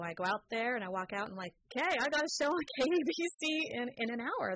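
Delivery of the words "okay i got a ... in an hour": high, fast, laughing excitement; thrilled. Retold or imitated. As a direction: burst out with delight. Thrilled at landing show.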